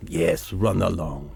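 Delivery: low, soft menacing voice of a big man; deep tough guy. Acted. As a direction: lean in low and threaten quietly.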